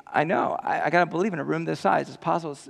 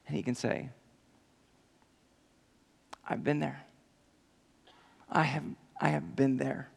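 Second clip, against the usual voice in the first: quiet sincere comfort to the hurting; hushed; 9 dB quieter than usual. Real, not acted. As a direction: hush down and speak it gently. Soft reflective close.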